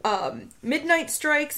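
usual speaking voice

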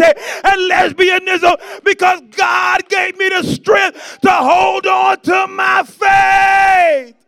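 high, full-force pulpit roar in rhythmic bursts; shouted preaching. Real, not acted. Preacher testifying that faith is rising, shouted at full force. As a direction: shout every phrase in surrender and triumph, stretching the last.

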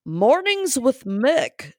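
show announcer. Pretend.

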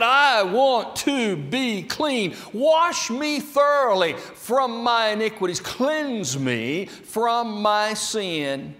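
high, loud pleading cry; impassioned plea. Acted. David begging God to cleanse him, loud and pleading. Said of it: "David said".

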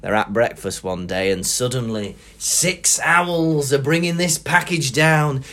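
ordinary delivery

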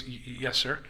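low, very soft stammer; timid kid imitation. Waiter cornered by Tommy Rich, meek stammering imitation. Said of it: "and the kid goes".

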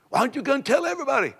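high-pitched rapid challenge to the critic; high fast challenge. Retold or imitated. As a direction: fire the question fast and high.

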